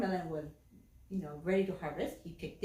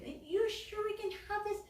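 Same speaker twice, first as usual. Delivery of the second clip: hesitant mom; 10 semitones higher, 4 dB quieter than usual. Acted. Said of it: "the mom was like".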